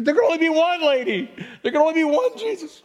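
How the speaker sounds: high-pitched, exasperated retort; mock exasperation. Retells exasperated reply to complaining lady.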